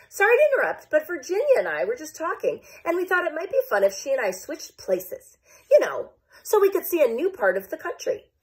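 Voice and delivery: high, sweetly polite voice; polite Idaho. Acted. Idaho politely addressing the party, high and sweet. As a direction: interrupt as nicely as you can. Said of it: "Idaho is a very polite state".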